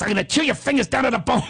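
hammed-up tough-guy impression; Joe Pesci. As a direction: imitate a movie burglar menacing a kid. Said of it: "he's doing a whole scene from the movie".

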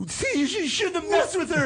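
high, mocking big-sister voice; sister's voice. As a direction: put on a high scolding sister voice. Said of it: "my sister was like".

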